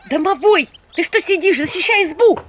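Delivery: loud, high urgent order; shouting. A narrator's reading. Heroine orders domovoi to defend hut, shouting. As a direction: shout for help at the house spirit. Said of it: "кричала я".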